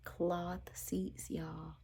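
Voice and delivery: unvoiced whisper, stunned; whispered horror; whispered disbelief. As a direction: breathe it out in horror. Repeating in disbelief.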